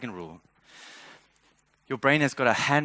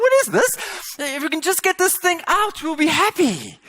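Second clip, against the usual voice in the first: exasperated outburst; 6 dB louder than usual. Retold or imitated. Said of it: "And I'm like".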